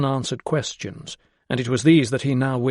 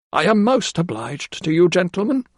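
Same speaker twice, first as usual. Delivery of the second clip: high, earnest woman's voice; a simple maid voicing her conscience; earnest housekeeper; 7 semitones higher than usual. A narrator's reading. Housekeeper thanks the gentlemen.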